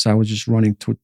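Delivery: ordinary delivery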